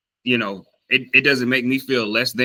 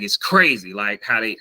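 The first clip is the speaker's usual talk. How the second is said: ordinary talk, nothing marked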